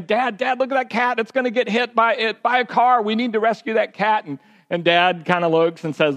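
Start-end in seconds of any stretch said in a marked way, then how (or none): 0.0-4.2 put-on higher-pitched pleading kids; a preacher acting out the kids begging Dad to stop; kids screaming. Kids in the back seat beg Dad to save the cat, raised pitch. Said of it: "but they start screaming".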